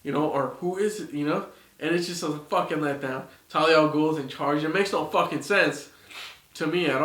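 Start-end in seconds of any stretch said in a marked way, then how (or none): none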